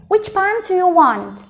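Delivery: "Which pants do you want?" linked in one flowing phrase; joined-up reading. Shown as a demonstration. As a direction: run "Which pants do you want?" together without pauses. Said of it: "đọc nhanh dần".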